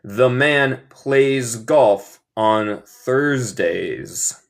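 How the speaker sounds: very slow, stretched, separated words; slow model reading. Shown as a demonstration. Teacher models the habit sentence for students, slow model reading. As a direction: dictate it slowly for learners.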